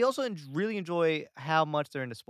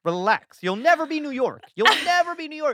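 louder, higher mock rant at a Boston fan; mock rant at the Boston stranger, louder and higher; exasperated retort; 4 semitones higher, 7 dB louder than usual. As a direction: tell the stranger off, building to a high shout. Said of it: "And I'm like".

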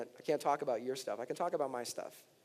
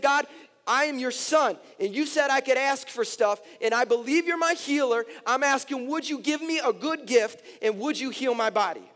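loud, high, impassioned plea; crying out to God; 10 semitones higher, 7 dB louder than usual. Retold or imitated. Pastor retelling his prayer for healing on his knees, crying out. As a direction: plead at full preaching force, retold. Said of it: "crying out and said".

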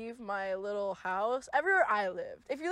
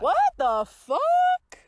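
much higher, loud, slow disbelief; stunned disbelief; 12 dB louder, much slower than usual. Real, not acted. Host reacting to co-host never seeing a Ralph's, loud slow disbelief. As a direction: drag it out in shock.

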